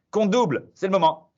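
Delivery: bright quiz-master hype; quiz host announcement. Acted. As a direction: pump up the players as you read the question. Host announces double-points question.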